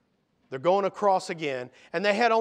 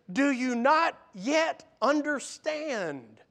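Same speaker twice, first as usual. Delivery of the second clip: slow, higher, exasperated question; 6 semitones higher, 3 dB louder, slower than usual. Acted. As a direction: space out each word in exasperation. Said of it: "there's some exasperation".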